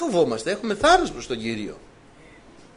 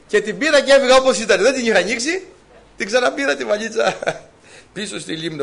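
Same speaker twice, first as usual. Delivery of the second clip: told through real laughter, louder; laughing; 5 semitones higher, 6 dB louder than usual. Real, not acted. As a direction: keep laughing while telling it. Laughing through the story's end.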